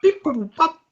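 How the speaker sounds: high robot beeps. Retold or imitated. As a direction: beep like a little droid. Imitating R2-D2 sounds.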